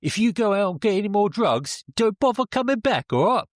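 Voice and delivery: high, loud put-on British accent; put-on girlfriend accent. Girlfriend warning her partner off drugs, in a put-on British accent. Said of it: "I forgot to do the accent".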